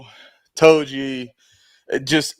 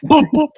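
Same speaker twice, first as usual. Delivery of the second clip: playful boop; 13 semitones higher, 8 dB louder than usual. Pretend. Prompting the host's sound effects.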